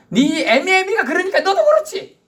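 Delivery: high, loud contemptuous jeer; scornful insult. Acted. Preacher voicing an insult, high and loud. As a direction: insult their parents.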